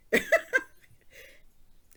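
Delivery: loud, high burst of laughter; high shrieking laugh. Real, not acted. Cracking up at her story.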